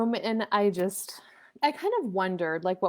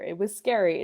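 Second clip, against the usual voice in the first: normal talking voice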